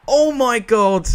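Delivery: slow stunned gasp of disbelief; drawn-out shocked exclamation. Real, not acted. Reacting to late goal.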